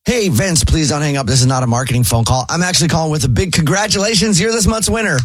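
bright, eager telemarketer patter; upbeat sales pitch. Acted. Host to caller, a cheery fake telemarketer pitch. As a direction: sell the prize like a cheery caller.

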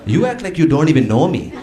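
guilt-trip the son like an exasperated Indian mom; mom's Indian accent. Retold or imitated. Mom turns her Indian accent back on to scold son. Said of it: "she turns it right back on".

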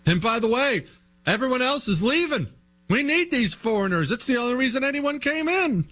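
high, sarcastic mocking voice; mock liberal voice. Mocking a pro-immigrant politician.

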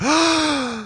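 high, shocked intake of breath; shocked gasp. Retold or imitated. Neighbors reacting to the tree news with a shocked gasp. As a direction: gasp like the whole street just heard. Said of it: "everyone in the neighborhood went".

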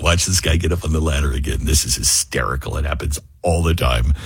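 low and soft, sly snickering aside; mocking trees. Ad read voicing trees laughing at homeowner, low sly snicker. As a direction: voice the trees as gleeful pranksters, hushed. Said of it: "'Cause it's like".

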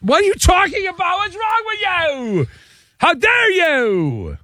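high, slow outraged yell; mock outraged shout. Pentagon officer outraged at caller.

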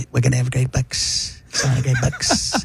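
soft Trump voice; hushed Trump with a laugh; library Trump. Acted. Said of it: "I do the library Trump".

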